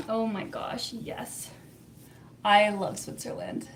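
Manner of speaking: ordinary talk, nothing marked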